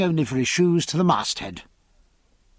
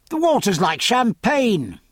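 cheerful call; 7 semitones higher than usual. Acted. Jack calls Stephen into sea.

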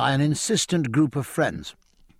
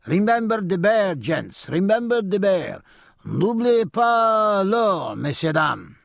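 higher, louder hawker's plea, drawn out; calling out for coins; 8 semitones higher, 4 dB louder, slower than usual. Acted. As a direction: beg loudly down the column. Bear leader begging from departing line.

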